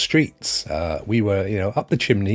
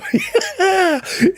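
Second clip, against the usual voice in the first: high laugh; 4 dB louder than usual. Real, not acted. Laughing at own joke.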